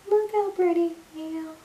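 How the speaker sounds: high cooing exclamation; high delighted coo. Real, not acted. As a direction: coo at it happily. Same words, cooing high.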